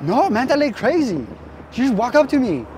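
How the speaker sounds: high, excited mock Tongan accent; roughly faked Tongan accent; Tongan bad-English impression.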